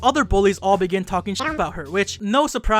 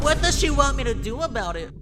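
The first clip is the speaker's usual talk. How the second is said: higher, petulant whining; boy sulking about rumours, higher petulant whine; mock whine; 5 semitones higher than usual.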